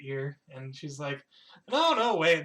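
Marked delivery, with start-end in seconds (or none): 1.7-2.4 loud, high, flustered blurt; flustered correction. Acted. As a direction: play a character frantically taking it back. Character panics at wrong item.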